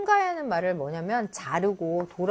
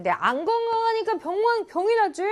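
a patient's retort pitched much higher; indignant patient; 13 semitones higher than usual. Acted. A sick patient retorting to a visitor, pitched high. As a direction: snap back at the careless wish.

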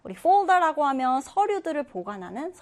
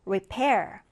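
"repair" with the stress on PAIR; stress on second syllable; 4 semitones lower than usual. Shown as a demonstration. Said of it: "두 번째 음절에 강세 두고". ("repair" 두 번째 음절에 강세)

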